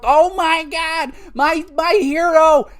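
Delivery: put-on high, slow whiny kid voice; imitating crying kids. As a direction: wail like a heartbroken kid. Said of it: "Kids in the arena are freaking out".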